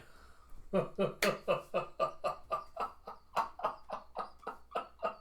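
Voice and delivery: high, helpless laughter; real, sustained giggling fit; long laugh. Real, not acted. Storyteller cracking up at the wand line, laughing.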